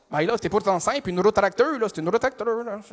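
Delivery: insistent Québécois customer; Québécois accent. Acted. Customer repeats order to confused cashier, insistent Québécois accent. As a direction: repeat it in broad Québécois, as if obvious. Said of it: "je vais faire un accent".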